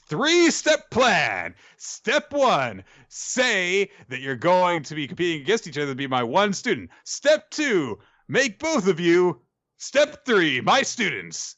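high, hammed-up proclamation; mock-triumphant scheming teacher, stretched words; grand plan reveal. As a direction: announce each step with big theatrical flourish. Acts sensei revealing grand plan.

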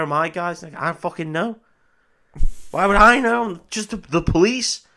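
high, incredulous mock outburst; indignant police chief. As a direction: sputter in high-pitched, exaggerated disbelief.

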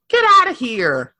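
ordinary delivery

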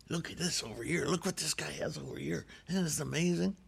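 lightly voiced father, softly showing off the gold; confiding old man. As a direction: lean in and marvel softly, like sharing a secret. Father shows son his treasures.

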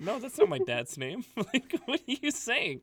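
high, loud laughing protest; mock outrage. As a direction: exclaim in comic disbelief. Mock outrage at Egyptian word.

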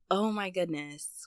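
ordinary talk

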